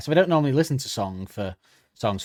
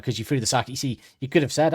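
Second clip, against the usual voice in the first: usual speaking voice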